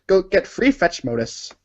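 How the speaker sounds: normal talking voice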